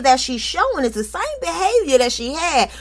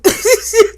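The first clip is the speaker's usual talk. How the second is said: high laugh burst; 11 semitones higher, 5 dB louder than usual. Real, not acted. Host laughs at the gossip.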